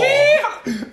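very high, laughing echo of a yeehaw; mock cowboy whoop. Echoing the friend's joke about country girls, giddy mock whoop. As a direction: squeal it back playfully.

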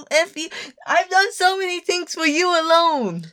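high-pitched, worked-up comeback; indignant protest. Real, not acted. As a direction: protest it, voice shooting up. Indignant reply to being called lazy.

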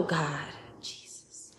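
whispered, slow invocation; overcome whisper. Real, not acted. Preacher overcome recalling God pouring in, whispered. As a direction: breathe the names out slowly in a whisper.

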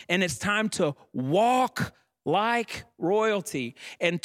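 preacher lifting the church to its feet; fervent preaching. Acted. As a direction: proclaim it to the congregation. Preacher builds to full force.

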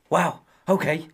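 a mock celebrity chef's reaction; Gordon Ramsay impression. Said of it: "Gordon Ramsay is my favourite".

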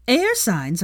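excited. Acted. Excited gossip.

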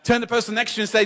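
ordinary talk, nothing marked

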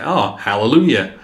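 understated imitation of a barely Southern accent; toned-down hallelujah without the big Southern flourish; not-so-Southern accent. Showing a co-host how he really sounds, restrained accent. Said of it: "but he's not. He's like".

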